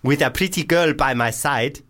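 put-on South African accent sliding towards Nigerian; put-on African accent, losing its grip; South African accent drifting. Host rereads listener's email, African accent going astray. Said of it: "is this going full African".

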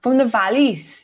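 slow, copied Welsh lilt; attempted Welsh accent. Shown as a demonstration. Copying co-host's valleys line, attempted Welsh accent. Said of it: "how do you say it?".

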